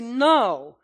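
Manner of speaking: preacher models a loud answer to the narrow-minded charge; loud firm retort. Shown as a demonstration. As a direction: turn the accusation back, loud. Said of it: "you have to say".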